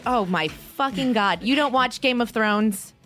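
ordinary talk

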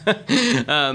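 a real chuckle mid-sentence; little laugh. Real, not acted.

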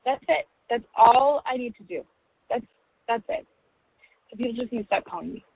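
normal talking voice

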